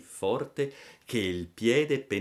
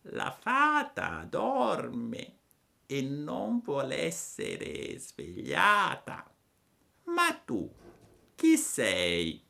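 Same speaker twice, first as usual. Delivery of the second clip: lenta e acuta, assonnata; voce di lumaca; 6 semitones higher, much slower than usual. A narrator's reading. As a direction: scandisci piano come una lumaca. (la lumaca risponde lenta e acuta)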